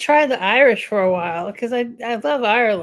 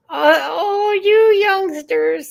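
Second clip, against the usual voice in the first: drawn-out, higher mock old-timer; oh-you-youngsters ribbing, stretched out and raised; 7 semitones higher, 5 dB louder, slower than usual. Streamer teasing viewers about Siri, mock old-timer.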